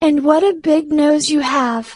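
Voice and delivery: put-on a small girl puzzled at grandma; young girl.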